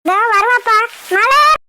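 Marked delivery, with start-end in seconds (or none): 1.1-1.6 higher-pitched call for someone out of sight. Acted. Relative summons the bride for her father, calling out.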